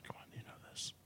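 hushed coaxing whisper. Real, not acted. Host whispering to a stuck co-host, urging him on. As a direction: breathe it as an urging nudge.

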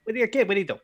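high-pitched rambling monologue comic; Jay Leno. Acted. Host character musing that kids are crazy, high-pitched impression. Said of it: "Jay Leno's here".

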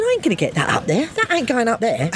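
fast, laughing protest; laughing refusal. Retold or imitated. Woman refusing to smuggle package, laughing protest. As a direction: protest while giggling.

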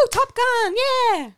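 high, loud whoop; excited cheer. Acted. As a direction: cheer like a jock. High five, woo cheer.

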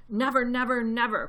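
vehement cry. A narrator's reading. Bogus backing the vow, crying out. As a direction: cry out each word with force. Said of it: "cried Bogus".

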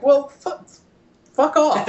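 high-pitched exasperated brush-off; fed-up retort. Retold or imitated. Buyer retelling a refused haggle, exasperated. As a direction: act out giving up on a stubborn seller, pitch jumping.

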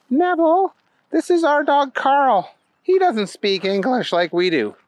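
put-on high-pitched girl making introductions; small, high little girl voice. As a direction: introduce the dog in a high child voice. Girl introduces her dog.

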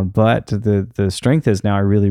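ordinary talk

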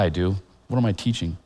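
ordinary delivery

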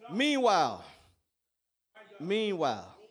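hushed, low and quiet; soft. Acted. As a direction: drop the voice right down. Sudden quiet after climax.

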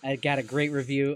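ordinary talk, nothing marked

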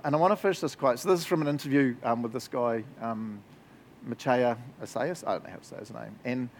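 ordinary talk

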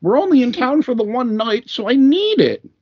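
high whiny imitation of a customer; mocking customer voice. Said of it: "She's like".

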